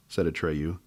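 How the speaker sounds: plain reading voice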